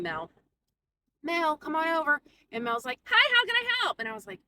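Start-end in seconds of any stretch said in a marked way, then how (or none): 1.2-2.2 higher-pitched call to a coworker. Retold or imitated. Cashier calls over coworker Mel. 3.0-4.0 very high, loud mock customer-service greeting; exaggerated, piercing helper voice; chirpy coworker. Mocking the overbright coworker Mel.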